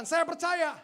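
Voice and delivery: loud, high burst of conviction; emphatic declaration. Real, not acted. Preacher affirming God's strategy to the church, loud declaration. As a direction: proclaim it as the sermon's climax.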